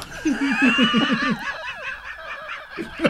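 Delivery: long high wheezing laugh; wheezy laugh. Real, not acted. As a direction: laugh with a wheeze on every breath. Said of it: "You sound really wheezy today".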